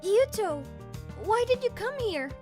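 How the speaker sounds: high, alarmed surprise; startled question. Acted. Girlfriend to boyfriend who came to fight, startled. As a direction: voice jumps up at an unexpected rescuer.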